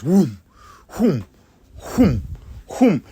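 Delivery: imitating the car swooping over the hills; car whoosh noises. Describing the car chase with whooshing sounds, playful. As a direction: whoom each hump like a roller coaster dip. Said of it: "we're going up and over the hills".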